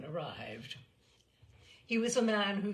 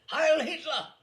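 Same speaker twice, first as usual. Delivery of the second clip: loud, higher shout; 9 semitones higher, 10 dB louder than usual. A narrator's reading. Narrator shouts at the major, loud and high. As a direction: bark the salute. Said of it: "I shouted".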